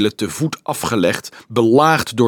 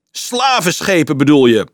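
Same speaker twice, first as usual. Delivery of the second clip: loud, raised, scornful challenge; shouted retort; 4 semitones higher, 5 dB louder than usual. A narrator's reading. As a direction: cut in and shout it at the speaker. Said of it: "schreeuwde Dolf". (fel geschreeuwde tegenwerping)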